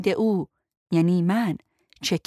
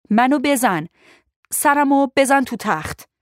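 louder, higher insistent order; urgent, raised-voice insistence; insistent command; 5 semitones higher, 6 dB louder than usual. Acted. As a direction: press the demand forcefully. Insists on being hit.

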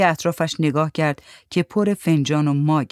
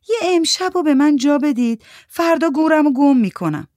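pleading; 10 semitones higher, 5 dB louder than usual. Acted. Mandana begs for one night.

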